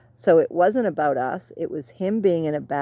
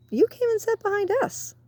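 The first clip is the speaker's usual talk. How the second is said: mock retort. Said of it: "I went".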